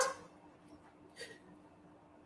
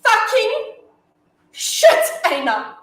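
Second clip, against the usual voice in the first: starts loud and builds to a full yell, laughing in fury; cursing rant; 4 semitones higher, 9 dB louder than usual. Acted. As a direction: swear it out, louder each time, until you're roaring.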